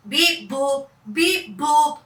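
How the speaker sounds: beeping alarm noises; alarm beeps. Acted. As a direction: imitate the alarms. Alarm sounds imitated.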